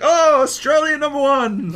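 high, loud broken-English cheer; hammed-up broken-English acclaim. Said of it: "it's super broken English".

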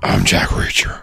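slow, throaty whispered growl; Batman voice. Acted. Co-host retrying his impression on a wrecked throat, raspy Batman whisper. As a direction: whisper it slowly like a brooding action hero. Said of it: "That was a terrible Batman voice".

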